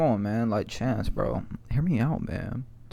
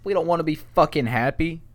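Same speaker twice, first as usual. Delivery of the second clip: mock rant; 4 semitones higher, 9 dB louder than usual. Ranting at happy people.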